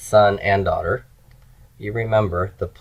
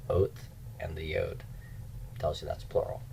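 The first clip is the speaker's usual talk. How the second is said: whisper; 15 dB quieter than usual. Real, not acted. Aside while pointing at board.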